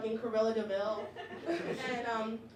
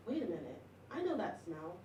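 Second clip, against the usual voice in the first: hushed realization; 5 semitones lower, 8 dB quieter than usual. Retold or imitated. Noticing smell in class.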